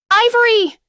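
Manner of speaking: high, loud yell of her name. A narrator's reading. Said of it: "Louisa yelled".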